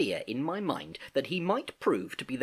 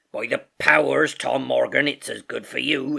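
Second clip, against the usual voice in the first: loud sailor's outburst; Silver exclaiming; 6 dB louder than usual. A narrator's reading. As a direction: exclaim as a stern seafaring landlord. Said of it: "exclaimed the landlord".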